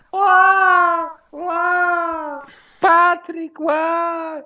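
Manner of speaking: high, slow, drawn-out mock sobbing on "ła"; mock wailing. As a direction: wail like a crybaby to mock him. Mocking friend for crying publicly.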